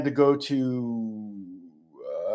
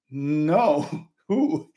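clueless surprise. Retold or imitated. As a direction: retell your own baffled reply. Asked about his unrecognized companion.

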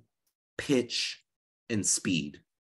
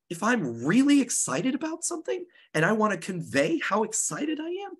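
much higher, excited voice; raised pitch; 12 semitones higher than usual. Shown as a demonstration. Teacher demonstrating excited delivery to the class, pitched high. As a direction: lift the pitch way up to sound thrilled. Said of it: "I might raise my pitch".

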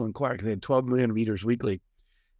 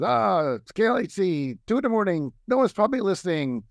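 radio DJ; 8 semitones higher than usual. Retold or imitated. Radio host talking to an empty late-night audience, DJ voice. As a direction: imitate a DJ on air, pitched up. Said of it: "this guy comes on".

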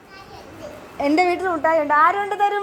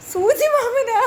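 higher voice, tickled and laughing; 4 semitones higher, slower than usual. Real, not acted. Adult repeating child's funny name for uncle, laughing. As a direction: question breaking into laughter.

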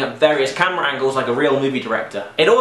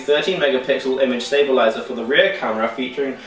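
product demo announcer. Pretend. Reads tech specs in a demo.

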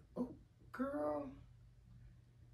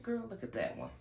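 high mock voice of God teasing; lightly pitched-up God voice; voicing God; 7 semitones higher, 7 dB quieter than usual. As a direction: play God amused at her. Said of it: "God be like".

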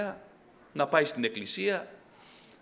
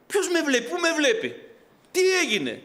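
amazed outcry; 9 semitones higher than usual. Retold or imitated. Converted man amazed, retold.

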